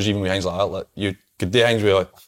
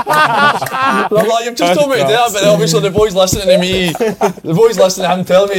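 higher and louder, laughing through the words; laughing while telling; 11 semitones higher, 7 dB louder than usual. Real, not acted. Retelling his coach's jibe to mates, laughing hard. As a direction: talk through giggles.

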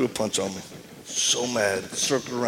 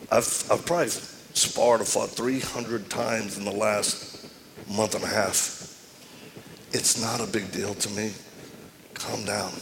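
calm; 5 semitones lower, 8 dB quieter than usual. Retold or imitated. Calmly talking the guy down.

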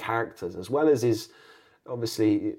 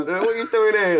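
mock taunt; 11 semitones higher, 6 dB louder than usual. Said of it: "teasing at football as a kid".

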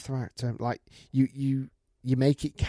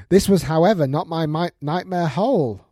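higher, louder storytelling; emphatic story read-out; 6 semitones higher, 6 dB louder than usual. Acted. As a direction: deliver the twist in the tale. Reads out a listener's email.

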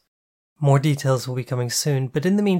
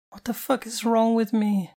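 despairing; 9 semitones higher than usual. Acted. Head in hands, despairing.

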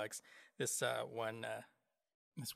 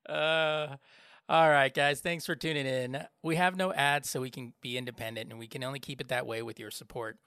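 much louder, upbeat announcer read; announcer plug; 3 semitones higher, 7 dB louder than usual. Acted. Host reading the podcast donation plug to listeners, loud announcer style. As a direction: sell it like a radio promo.

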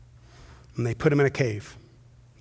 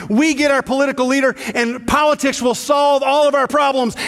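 higher and louder, the crowd's hungry hope; eager crowd voice; 10 semitones higher, 6 dB louder than usual. Acted. Crowd at the palm entry demanding a leader, eager raised voice. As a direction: voice the crowd cheering for a king.

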